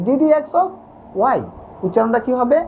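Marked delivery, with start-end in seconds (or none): 0.1-1.4 "d dx of y" run together quickly; quick pronunciation. Shown as a demonstration. Teacher shows students how to say it faster, quick and joined. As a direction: say "d dx of" in one go, then "y". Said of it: "যদি তাড়াতাড়ি বলতে চাও তাহলে এভাবে".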